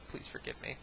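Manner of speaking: soft, low-key mock plea; mock apology. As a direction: say sorry quietly, half under your breath. Mock apology, first quieter plea.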